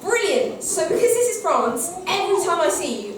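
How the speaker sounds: normal talking voice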